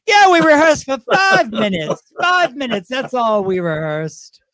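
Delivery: high, loud comic protest; mock insistence. Queen jokingly covers for the show after saying too much, mock insistence. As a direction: loudly insist it as a joke.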